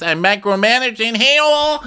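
high, loud rising cry; hammed-up dread; mock dramatic wail. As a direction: wail it like a horror sting. Mock horror.

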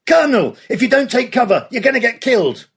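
officer in a pillbox yelling a warning to the colonel; yelled warning. Acted. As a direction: shout the warning across gunfire. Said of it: "yelled a warning at Canham".

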